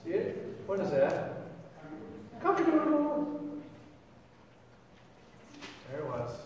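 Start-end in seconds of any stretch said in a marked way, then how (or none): 2.4-3.4 high imitated cock-a-doodle crow; rooster crow. Preacher voices the rooster after the denial, high crow.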